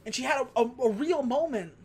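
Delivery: normal talking voice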